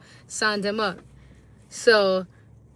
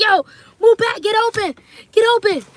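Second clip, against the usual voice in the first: much higher, louder sideline shout; soccer mom yell; 12 semitones higher, 8 dB louder, faster than usual. Retold or imitated. Soccer mom yelling to her son on the field. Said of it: "I was yelling like".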